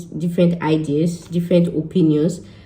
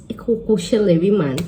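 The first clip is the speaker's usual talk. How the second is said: ordinary talk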